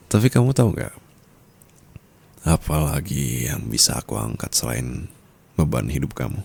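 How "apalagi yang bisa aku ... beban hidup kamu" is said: usual speaking voice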